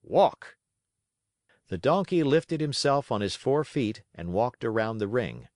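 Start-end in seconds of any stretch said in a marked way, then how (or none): 0.0-0.6 loud, high command; sharp cry. A narrator's reading. Manager cracking the whip at Pinocchio, sharp loud cry. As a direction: snap the order across the ring. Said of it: "cried sharply".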